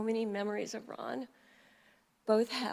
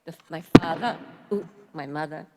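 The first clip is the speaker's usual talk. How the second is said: father and mother with the New York dropped R, like "fatha" and "motha"; New York accent; 4 semitones lower, 10 dB louder, faster than usual. Retold or imitated. Eulogist imitating Ron's speech, a New York accent, the first word loud. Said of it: "New York City manner of speaking".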